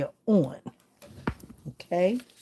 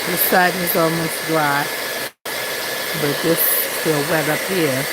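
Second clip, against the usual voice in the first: over hair dryer; 4 semitones higher, 4 dB louder than usual. Real, not acted. Talking over running blow dryer.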